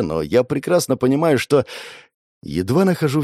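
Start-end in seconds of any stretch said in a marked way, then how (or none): none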